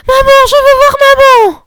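put-on a frightened small child crying out. A narrator's reading. The little girl wakes and calls her mother, crying out.

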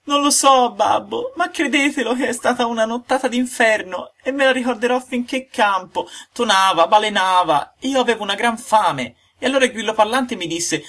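put-on high, bright-eyed wooden boy pouring out his story; a breathless little puppet recounting a terrible night; high puppet voice, loud and eager. A narrator's reading. Pinocchio's voice telling his night.

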